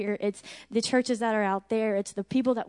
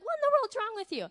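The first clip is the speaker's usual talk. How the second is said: high, fast retold exclamation; imitated bewilderment; 13 semitones higher, faster than usual. Speaker voices friend reacting to her tears, bewildered. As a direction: imitate someone taken aback, pitched way up and quick.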